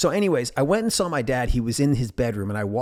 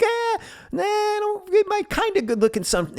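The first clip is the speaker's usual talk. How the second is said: joking dad; 5 dB louder than usual. Retold or imitated. Dad playing along jokingly.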